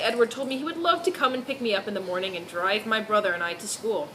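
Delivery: ordinary talk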